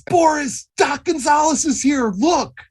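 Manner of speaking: high, loud warning; urgent call. Acted. Luther calling Boris about Gonzalez, high loud urgent. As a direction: shout the warning.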